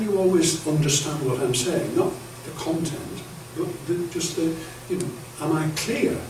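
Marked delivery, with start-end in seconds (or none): none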